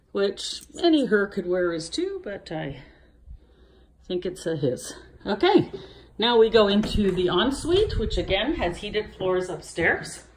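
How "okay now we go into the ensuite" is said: ordinary talk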